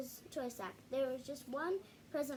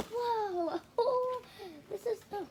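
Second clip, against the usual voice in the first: loud high gasp of wonder; real delight at the pop-up page; surprised whoa; 9 semitones higher, 6 dB louder, slower than usual. Real, not acted. Child reading to viewers, amazed by the pop-up. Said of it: "child amazed at pop-up page".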